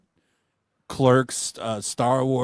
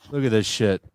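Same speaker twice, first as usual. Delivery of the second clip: ordinary talk, nothing marked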